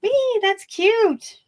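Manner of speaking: very high, slow gushing; excited squeal. Real, not acted. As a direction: squeal with delight. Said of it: "I get excited".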